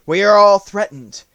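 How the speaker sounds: high, loud alarmed tiger; Tiger's voice. A narrator's reading. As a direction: cry out the danger.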